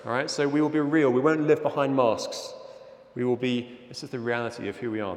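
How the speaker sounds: normal talking voice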